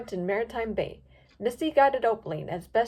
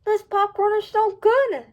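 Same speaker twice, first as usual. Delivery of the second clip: high, loud, slow chewing talk; mouth full; 11 semitones higher, 7 dB louder, slower than usual. A narrator's reading. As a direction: talk through a mouthful of popcorn. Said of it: "with her mouth full".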